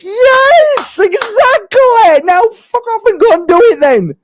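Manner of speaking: high, loud, slow yes, then a bark to go; excited shout. Retold or imitated. Healer yelling approval at the parents, high and loud. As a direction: yell it with glee.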